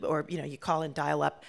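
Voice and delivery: normal talking voice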